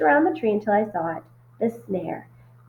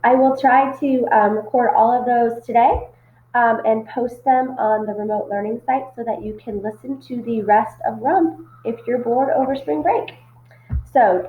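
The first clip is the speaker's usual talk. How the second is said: ordinary delivery, nothing marked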